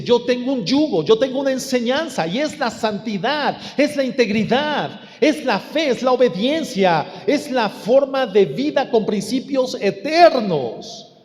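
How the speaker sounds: high-pitched, building pulpit proclamation; impassioned preaching. Real, not acted. Preacher voices the Lord's teaching, impassioned preaching.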